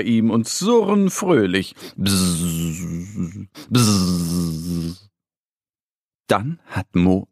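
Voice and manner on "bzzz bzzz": mosquito buzz. Acted. Narrator imitates mosquito buzz.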